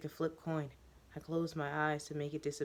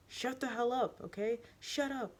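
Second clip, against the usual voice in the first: high, sharp angry snap; snapping; 8 semitones higher than usual. Acted. The teacher snapping at the student. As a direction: cut her off hard. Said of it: "I snap".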